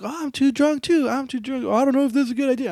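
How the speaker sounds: high mock-whiny excuses; imitated drunk buddies. Drunk friends refusing to drive, high imitated whining. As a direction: pitch it up like drunk pals backing out.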